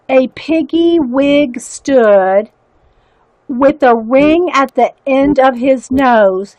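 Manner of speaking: slow, lightly read-aloud verse for viewers; poem reading. Acted. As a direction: savour each line of the poem. Reads poem aloud slowly.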